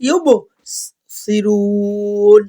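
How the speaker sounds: very slow chant, qalqala on বো, three-alif stretch on সিরুন; tajweed recitation. Shown as a demonstration. Teacher demonstrating the madd and qalqala, slow chant. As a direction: stretch সিরুন long and bounce the ba. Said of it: "তাহলে এখানে বলবেন".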